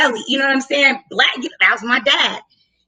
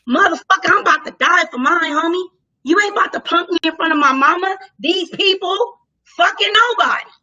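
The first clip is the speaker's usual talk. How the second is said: defiant rage; 5 semitones higher than usual. Retold or imitated. Standing up to the deputy in front of her mom, defiant. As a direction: rise up and refuse to back down.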